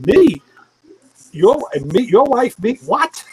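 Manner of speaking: jumping high and loud, sputtering in shock; stunned disbelief. Retold or imitated. Retelling his reaction when Neil says it involves him, in high loud disbelief. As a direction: react like the floor just dropped out.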